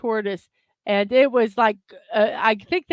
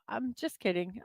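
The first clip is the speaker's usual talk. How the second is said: ordinary talk, nothing marked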